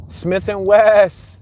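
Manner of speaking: drawn-out announcement of "Smith and West"; announced street name. Shown as a demonstration. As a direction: stretch out "Smith and West" like calling the stop. Repeating street sign, announcing.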